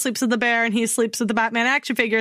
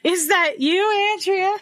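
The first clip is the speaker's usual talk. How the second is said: mock séance calling; 7 semitones higher than usual. Mock calling to dead Andrea.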